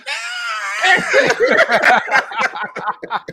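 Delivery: big shrieking laugh; loud high laugh. Real, not acted. Laughing at Maury chaos.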